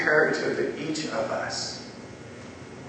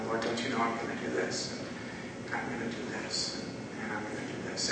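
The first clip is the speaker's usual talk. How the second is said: hushed, confiding near-whisper of a confession; retold whisper; 3 semitones lower, 16 dB quieter than usual. Praying man confides his sins in Jesus's ear, whispering. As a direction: lean in and murmur it very softly. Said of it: "I would whisper all my sins".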